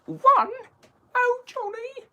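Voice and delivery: very high falsetto lady; mock-feminine falsetto; Elizabeth Swann. Shown as a demonstration. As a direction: squeak it out as a put-on woman's voice. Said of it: "Can Jack do an Elizabeth Swann impression?".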